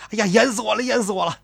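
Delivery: high, loud cries of drowning; 连声大叫. A narrator's reading. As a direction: yell it like you're going under. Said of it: "连声的大叫". (高声惊叫：淹死我了)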